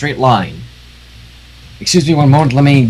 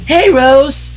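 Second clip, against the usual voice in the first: high, bright call; high chirpy hello; Pinkie greeting; 11 semitones higher than usual. Acted. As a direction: call out cheerily to a friend. Pinkie calling to friend.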